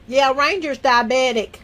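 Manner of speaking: usual speaking voice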